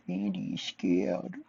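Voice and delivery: put-on baby talk voice. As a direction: coo it like a grown man doing baby talk. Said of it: "Andy does the baby talk voice".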